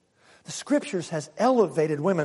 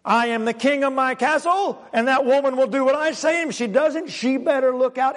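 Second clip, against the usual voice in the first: higher, louder bully boast; domineering husband; 7 semitones higher, 4 dB louder than usual. Retold or imitated. Preacher mocks a controlling husband, higher and louder. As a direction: puff up as the self-made king of the castle. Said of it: "That was his thinking".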